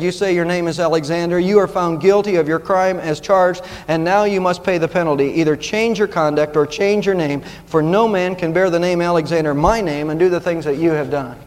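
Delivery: normal talking voice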